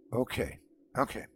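calm a scared friend softly; gentle reassurance. Acted. Friend gently calms the shaken narrator, softly reassuring. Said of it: "gently helping me to my feet".

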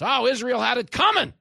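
loud, high-pitched indignant excuses; hammed-up self-righteous protest; mock victim-blaming. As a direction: imitate strident apologists shouting justifications. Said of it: "They start blaming the victims".

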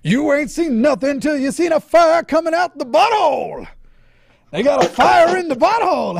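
high, loud fiery preacher. Retold or imitated. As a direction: preach it with full fire. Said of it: "that preacher that was saying".

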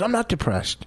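high, mocking imitation of the wife. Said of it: "I didn't say it like that".

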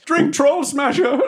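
high, drawn-out plug; mock advertising shout. Player heckling the announcer with a drink plug, high mock shout. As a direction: holler the sponsor's drink, amused.

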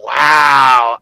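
loud, stunned exclamation; amazed wow. Retold or imitated. Guy retelling his amazement at the girl's show. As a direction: blown away and saying it out loud. Reacting to her squirting.

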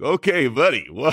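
sarcastic okay buddy. Retold or imitated. To a wild politician, a wary amused okay buddy. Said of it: "And you're like".

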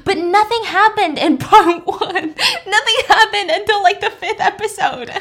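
high, loud, laughing protest; real amused exasperation, words breaking into laughter. Real, not acted. Exasperated aside, laughing through it.

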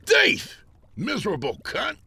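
high-pitched jeering outbursts; accusing cries. A narrator's reading. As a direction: cry out accusations in a high character voice.

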